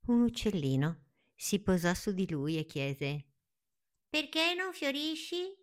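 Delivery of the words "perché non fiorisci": very high, light bird voice; high little bird asking. Acted. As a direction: chirp it like a tiny curious bird.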